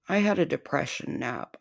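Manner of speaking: fast mock whisper. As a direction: breathe it out as a fake sad confession.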